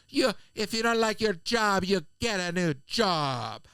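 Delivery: slow, lilting imitation Jamaican accent; musical Jamaican accent. Retelling a passenger's telling-off, musical imitated Jamaican accent. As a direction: make it sing in a put-on Caribbean accent. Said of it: "It's like music".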